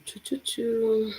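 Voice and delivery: ordinary delivery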